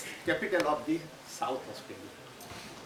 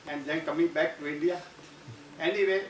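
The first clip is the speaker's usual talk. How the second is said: usual speaking voice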